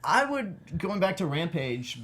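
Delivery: ordinary delivery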